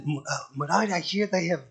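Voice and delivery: lightly imitated Indian accent, eager, breaking into a laugh; a light Indian accent, bubbling over with excitement; excited Indian accent. Vamsi telling Murari about the beaches, eager light Indian accent with a laugh. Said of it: "Vamsi, he was very excited".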